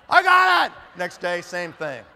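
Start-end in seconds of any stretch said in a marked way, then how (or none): 0.1-0.7 high loud laughing shout; pumped-up shout. Retold or imitated. Recruit pumped up again, excited shout. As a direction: yell it fired up.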